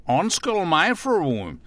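put-on high, polite American-accented call for attention; høflig amerikaner. A narrator's reading. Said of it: "sagde amerikaneren høfligt". (høfligt med amerikansk accent)

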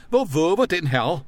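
high, loud indignant cry; fornemt, vredt skrig. A narrator's reading. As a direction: shriek it in posh outrage. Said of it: "mens han skreg". (skriger vredt, men fornemt)

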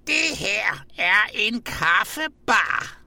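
high, slow, drawn-out shout; råbende. A narrator's reading. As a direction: proclaim it slowly at a high pitch, word by word. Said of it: "og råbte". (råber højt og langsomt)

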